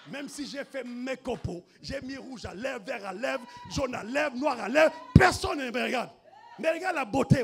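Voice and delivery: plainte qui monte jusqu'au cri; hammed-up complaint, listed item by item, building to a shout; plainte jouée. (plainte théâtrale qui finit en criant)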